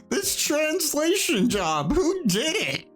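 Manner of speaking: high, squealing words through real laughter; laughing hard. Real, not acted. Streamer cracking up at a bad game translation, laughing hard.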